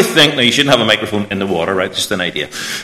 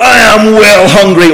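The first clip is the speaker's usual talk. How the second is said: put-on little boy voice; 9 semitones higher, 8 dB louder than usual.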